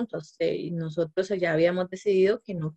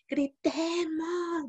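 high and very slow, coaxing the yell; playful let's shout; 8 semitones higher, much slower than usual. Retold or imitated. Teacher inviting the furious child to yell, playfully drawn out. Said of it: "Grita, ¿verdad?".

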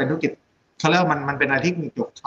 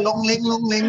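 higher, singsong imitation of Chinese babble; mock Chinese chatter; 9 semitones higher than usual. Describing foreign patients filling the hospital, mock Chinese chatter. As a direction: imitate overheard Chinese chatter in a raised pitch. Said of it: "นี่เดี๋ยวภาษาจีน".

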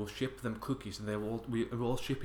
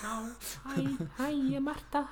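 very high squeaky put-on friend voice; playing Marta; 13 semitones higher than usual. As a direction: play the friend popping in, pitched way up. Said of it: "Oh, Marta, you're here".

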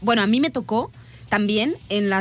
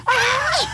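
mock pained cry then laugh. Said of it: "y yo".

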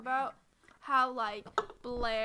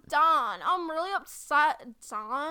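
loud, clenched-jaw mock line; Serena jaw-locked impression; 3 semitones higher, 10 dB louder, slower than usual. Imitating the actress's locked-jaw delivery for a friend, loud. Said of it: "She goes".

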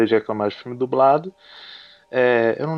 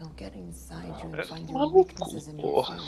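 cursing under breath; 4 semitones higher, 9 dB quieter, much slower than usual. Real, not acted. Muttered curse at movie.

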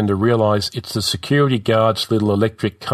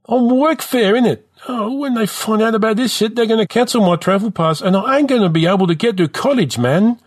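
high-pitched, dejected lament; despondent; 12 semitones higher, 3 dB louder than usual. A narrator's reading. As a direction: pour out your troubles high and hard-done-by. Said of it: "Rizza says, despondent".

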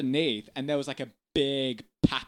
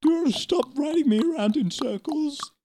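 high-pitched talking horse; whinny out the complaint, high; put-on horse voice, pitched way up; complaining horse; 11 semitones higher, 4 dB louder than usual. Speaks as the ridden horse.

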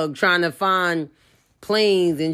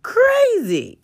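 much higher, shrill-style exclamation; high incredulous crazy; squealed disbelief. Real, not acted. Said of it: "this is craziness".

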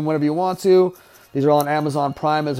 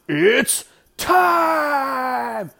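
drawn-out ring announcer shout; Bruce Buffer; 11 semitones higher than usual. Retold or imitated. Commentator mimicking a fight announcer's catchphrase, long high shout. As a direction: bellow it high, stretching "time" out long. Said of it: "that's where Bruce Buffer stole that shit".

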